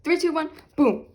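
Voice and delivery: ordinary delivery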